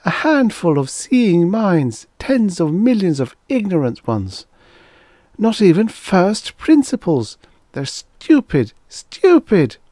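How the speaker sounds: high, loud, anguished female voice; despairing. A narrator's reading. She despairs over the ignorant Earth beings, anguished. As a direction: cry it out high, rising in despair. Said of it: "said Laloi despairingly".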